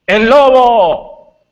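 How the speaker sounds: high, loud, slow cry of el lobo; shouting journalist. Acted. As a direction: stretch out the warning to alarm everyone.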